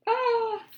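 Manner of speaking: high, loud mock scream; jump-scare yell for the kids; scary shout. Scary picture reveal.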